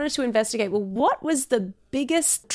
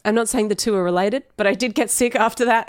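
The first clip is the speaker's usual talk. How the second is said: ordinary talk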